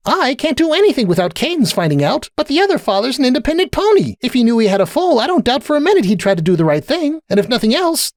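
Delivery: high, insistent self-defence; indignant protest. A narrator's reading. Sunburst justifying the letter, high and indignant. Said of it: "in an indignant frown".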